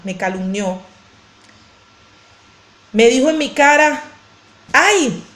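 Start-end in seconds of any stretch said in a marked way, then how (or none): 4.7-5.2 mock indignant jab, a little raised; imitated angry accuser. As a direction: imitate someone accusing you to your face. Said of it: "me dijo así".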